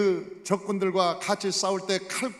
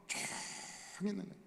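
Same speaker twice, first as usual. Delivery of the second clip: hushed; 16 dB quieter than usual. Acted. Preacher showing Israel's frightened silence, hushed. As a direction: shrink the word into a long hush. Acts out the army keeping silent.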